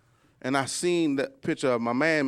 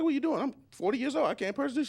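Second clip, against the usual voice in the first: indignant; 10 semitones higher, faster than usual. Retold or imitated. Indignant at the cashier.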